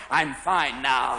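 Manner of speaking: low, slow, drawn-out declaration; reformed patient. Acted. Patient at Betty Ford, drawn-out low assurance. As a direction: stretch it out low and long. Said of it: "at Betty Ford Hospital going".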